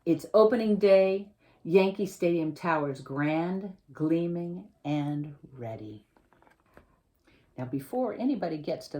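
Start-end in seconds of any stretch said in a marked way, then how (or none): 0.1-6.1 picture-book reading, lively and clear; reading to children. Acted. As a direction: read the story to a class of kids. Author reads picture book aloud.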